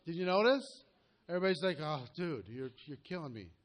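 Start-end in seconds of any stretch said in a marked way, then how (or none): 1.8-3.5 a worn-out, drained, softer moan; mock exhausted groan. Exhausted trainee groaning to the coach, mock worn-out moan. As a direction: play the wiped-out trainee, half-hearted.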